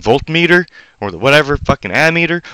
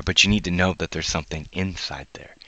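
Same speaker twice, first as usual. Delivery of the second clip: whisper; 7 semitones lower, 11 dB quieter than usual. Real, not acted. Confiding a secret.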